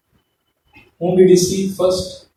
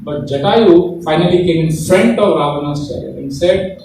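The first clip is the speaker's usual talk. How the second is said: ordinary talk, nothing marked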